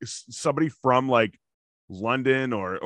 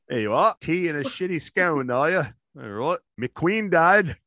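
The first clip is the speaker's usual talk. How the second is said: hammy British lilt with rising tags; mock British accent; 2 semitones higher than usual. Co-host needling the other about scones, mock British accent. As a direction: keep piling on the fake British accent. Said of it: "a British accent the entire show".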